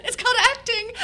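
high, loud punchline through laughter; retold comeback, squealed with laughter; laughing retort. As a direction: crack up while delivering the comeback.

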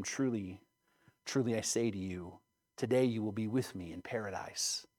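low, hushed promise; quiet assurance. A narrator's reading. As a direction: speak softly and solemnly to a dying man. Jesus reassuring the dying criminal.

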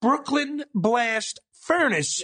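Brooklyn Blast Furnace said very slowly, word by word; slow teacher voice repeating the name; slow demo. Shown as a demonstration. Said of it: "repeat after me".

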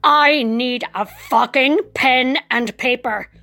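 slow, deliberate, emphatic; intense inner command. Acted. Patient willing the aide by mind control: slow, pointed insistence. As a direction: stare them down word by word. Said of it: "I say in my head".

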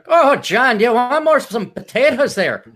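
high, loud Irish mother; hammed-up Irish mam, slowing at the end; Irish brogue. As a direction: try a fussing Irish mom's brogue. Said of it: "do it with an Irish brogue".

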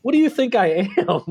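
retelling a baffled reaction to fans wanting pictures; incredulous disbelief. Retold or imitated. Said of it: "And I'm like".

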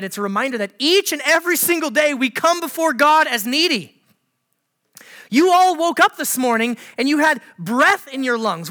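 fervent preacher building up; preaching at full force. Acted. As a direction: preach it to the whole room.

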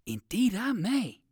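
put-on a gentle old rabbit musing; lightly voiced old rabbit. A narrator's reading.

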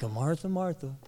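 mildly annoyed Martha, Martha; lightly exasperated rebuke. Shown as a demonstration. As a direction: sigh out Martha, Martha with a touch of irritation. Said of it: "not saying in an annoyed tone".

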